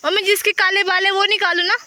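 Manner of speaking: high, loud call across the field. Real, not acted. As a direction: shout the request to mother far away. Calling to mother across field. (खेत में mummy को ज़ोर से पुकारना)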